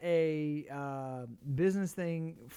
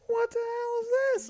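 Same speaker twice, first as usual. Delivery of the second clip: mock astonished crowd; 4 dB louder than usual. Everyone reacting to huge plate.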